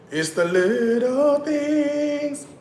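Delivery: high, slow, drawn-out sigh of delight; mock swooning; dreamy. Savouring simple pleasures.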